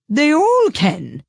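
put-on high, slow, gruff old duchess; rough, haughty duchess voice. A narrator's reading. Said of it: "said the Duchess".

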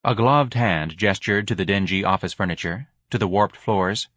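usual speaking voice

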